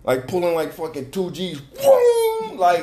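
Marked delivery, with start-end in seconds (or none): 1.6-2.6 high, loud vocal car boom; sudden booming sound effect, pitched way up; imitated boom. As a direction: belt out a crash noise.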